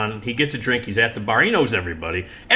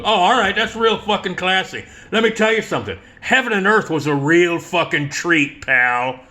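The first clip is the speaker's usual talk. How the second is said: high, louder sarcastic taunt; sarcastic jab; 7 semitones higher, 3 dB louder than usual. Retold or imitated. Joe taunting Oliver Stone as he walks away, sarcastic insult. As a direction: sneer the compliment up high and loud. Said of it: "and Joe goes".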